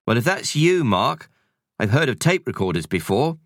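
brisk housekeeper voice; busy cleaning lady shooing kids; Missus Kersey voice. Acted. Housekeeper suspects a prank.

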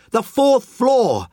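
drawn-out high scream; slow scream. Acted. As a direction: stretch each word as you scream it. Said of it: "the man screamed".

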